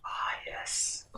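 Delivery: hushed, knowing ah yes; whispered aside. Real, not acted. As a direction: breathe it out like a secret. Player relishes persuasion skill.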